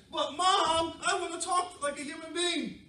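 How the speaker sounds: high, loud pleading pup; whining puppy. Acted. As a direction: beg Mom like an eager little pup. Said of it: "the puppy said".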